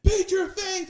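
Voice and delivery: very high, strained mock yell; imitating yelling sergeant. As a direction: yell the order in imitation, without full volume. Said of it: "they're yelling at me".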